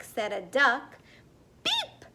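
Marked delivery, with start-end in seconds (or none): 1.6-2.0 short, very high beep; a friendly little horn toot; friendly truck beep. A narrator's reading. Narrator voicing the friendly blue truck, high beep. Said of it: "said the friendly little blue truck".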